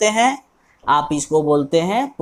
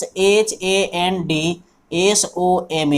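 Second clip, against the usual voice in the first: slow, letter by letter spelling; teacher spelling handsome slowly; much slower than usual. Shown as a demonstration.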